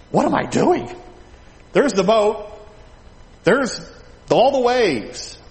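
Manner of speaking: high, halting alarm; mock panic. Peter on the water noticing the waves, high mock panic. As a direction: act out rising panic in a high voice.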